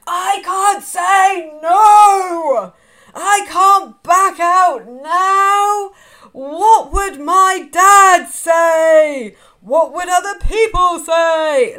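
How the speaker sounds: very loud, very slow, high panicked yelling; bloody-murder yell, drawn out; ego screaming. Retold or imitated. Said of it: "my ego screamed bloody murder".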